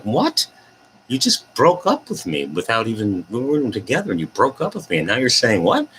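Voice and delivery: high-pitched incredulous woman, imitated; mock outraged disbelief; confused and mad. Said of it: "she's, like, all confused and mad".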